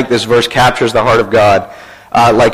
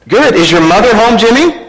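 lightly acted higher-pitched caller; telemarketer; 8 semitones higher than usual. Telemarketer questioning a small boy, lightly acted higher voice. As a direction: coax a small child with a question. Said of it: "A telemarketer".

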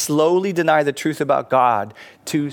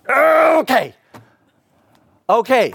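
high, loud mock yell; loud, hammed-up exasperated consent; exasperated giving in; 11 semitones higher, 6 dB louder, slower than usual. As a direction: cry out a grudging surrender. Exasperated professor gives in to begging.

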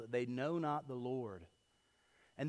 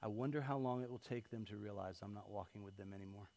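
quiet musing; 6 semitones lower, 10 dB quieter than usual. Retold or imitated. Parent wondering when the kids notice, said quietly. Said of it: "I've done it".

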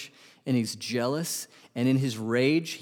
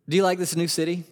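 ordinary talk, nothing marked

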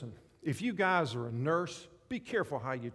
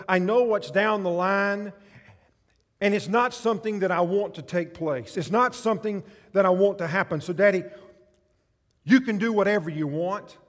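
anguished prayer; 4 semitones higher, 8 dB louder than usual. Acted. Jesus pleading with his Father, loud anguished cry. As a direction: cry it out to God, begging.

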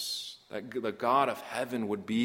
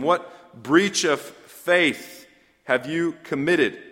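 loud, raised preaching voice; fervent preaching; 5 semitones higher, 7 dB louder than usual. Acted. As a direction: preach it out full force to the congregation. Preaching Phinehas's charge, loud.

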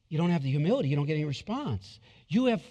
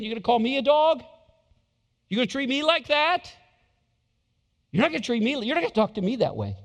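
offended indignation; 5 semitones higher, 4 dB louder than usual. Retold or imitated. Mocking an offended believer.

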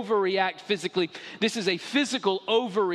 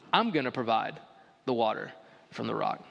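proud Moses; 7 semitones lower than usual. Acted. Moses tells the people he will provide, low and proud. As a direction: drop the voice low and claim the credit. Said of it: "stood in front of the people and said".

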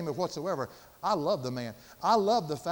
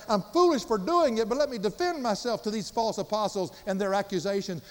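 raised, ringing pulpit voice, building through the list; preaching at full force; 5 semitones higher, 4 dB louder than usual. Acted. Preacher to congregation, loud impassioned sermon delivery. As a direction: preach it loud and high, hammering each danger.

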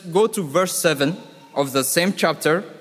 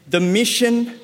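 "mission" pitched up high and pressed hard; stress on mission; 6 semitones higher than usual. Shown as a demonstration. Preacher correcting his hearers, leaning hard on "mission". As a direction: punch "mission" to correct them.

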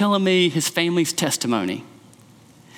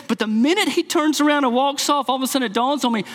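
normal talking voice

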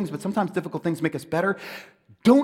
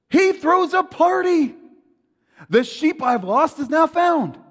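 higher, louder, slowed celebration; joyful announcement; 9 semitones higher, 4 dB louder, slower than usual. Acted. Preacher tells of the shepherd calling friends to celebrate, joyful. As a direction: announce the good news with delight.

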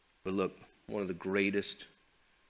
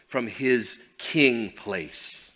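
ordinary delivery

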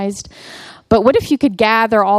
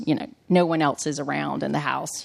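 ordinary delivery

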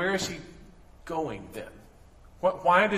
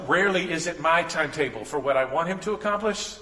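ordinary talk, nothing marked

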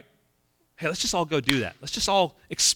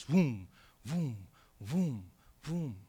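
1 soft gliding vroom; 2 semitones lower, 9 dB quieter, much slower than usual. Retold or imitated. Imitating smooth gliding.

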